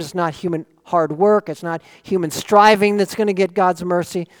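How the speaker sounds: ordinary talk, nothing marked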